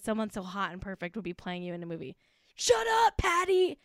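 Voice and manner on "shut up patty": mock outrage. Mock scolding of absent Patrizia.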